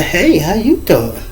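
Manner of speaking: slow, drawn-out hello in her voice; her inviting greeting. Retold or imitated. The woman greeting him with a slow, inviting hello. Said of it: "'Cause she said".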